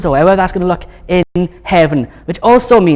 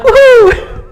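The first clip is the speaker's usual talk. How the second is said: squealed woohoo, much higher and louder; Pam's cheer; 6 dB louder than usual. Retold or imitated. Pastor imitating Pam cheering in church, high loud squeal. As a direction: mimic an excited congregant's whoop. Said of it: "Pam's like".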